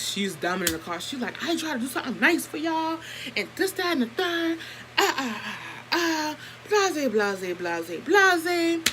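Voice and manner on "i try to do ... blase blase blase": higher, softer, with fake sobs; a mocking imitation of a whiny sulk with fake crying. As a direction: mimic a sulking cousin whimpering excuses. Mocking the sulking cousin's complaint.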